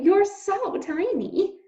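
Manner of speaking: high, slow, cooing princess; doting princess. Acted. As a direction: coo it, charmed by the tiny mouse. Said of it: "said the princess to Despereaux".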